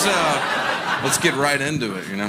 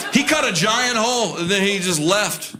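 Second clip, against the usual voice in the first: ordinary talk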